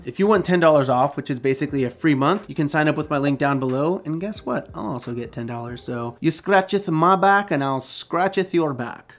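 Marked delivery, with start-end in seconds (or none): 5.8-9.2 put-on slow Old English meets Mexican accent; muddled mock accent. Trading favors with viewers, in a muddled mock accent. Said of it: "No idea what accent that was".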